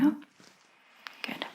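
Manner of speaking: normal talking voice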